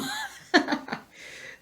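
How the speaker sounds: a squeaky high giggle; high laugh. Real, not acted. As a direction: let out a high little laugh. Laugh after exclaiming.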